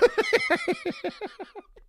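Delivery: loud, high burst of laughter; real laughing fit. Real, not acted. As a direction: crack up at the chat.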